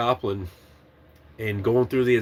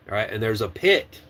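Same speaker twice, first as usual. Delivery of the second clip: usual speaking voice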